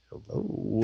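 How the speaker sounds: very soft, nearly voiceless hello; hushed tentative hello. Retold or imitated. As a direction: answer an unknown voice under your breath. His own hushed reply to voice.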